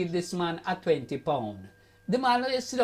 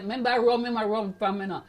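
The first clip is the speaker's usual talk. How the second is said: ordinary talk, nothing marked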